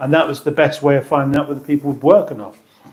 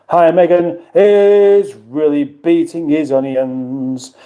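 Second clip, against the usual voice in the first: usual speaking voice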